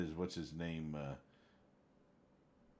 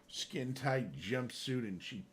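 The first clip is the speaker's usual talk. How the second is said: ordinary talk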